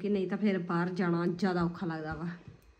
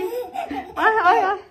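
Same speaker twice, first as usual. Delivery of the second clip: laughing; 12 semitones higher, 7 dB louder, much slower than usual. Real, not acted. Laughing with baby.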